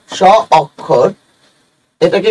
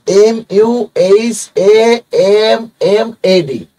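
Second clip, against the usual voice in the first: very slow, letter by letter, M U H A M M A D; spelled out slowly; 2 semitones higher, much slower than usual. Shown as a demonstration. Teacher spelling the name for students, very slowly. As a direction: dictate each letter clearly and slowly. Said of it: "এভাবে লিখবেন".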